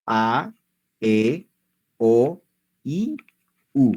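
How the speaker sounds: A, E, O, I, U one by one, with pauses; vowel pronunciation drill. Shown as a demonstration. Teacher running the whole vowel set for learners, separate clear vowels. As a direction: sound out each of A, E, O, I, U separately. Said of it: "Ahora todo completo, vamos".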